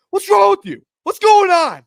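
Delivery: high, loud retold shout at the kids; imitating a furious dad's high, loud shout; dad yelling. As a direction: bellow it like an angry dad breaking up a fight. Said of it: "we were screaming".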